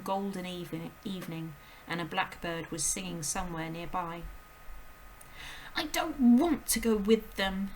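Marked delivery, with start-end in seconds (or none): 5.8-7.7 put-on loud, slow defiant girl; high, loud child's outburst; shouting. A narrator's reading. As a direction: refuse with all her force. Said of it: "Matilda shouted suddenly".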